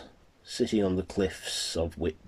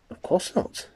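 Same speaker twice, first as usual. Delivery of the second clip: Lucy's voice; 14 semitones higher, 3 dB louder than usual. Acted. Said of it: "said Lucy, looking aroused and pleased".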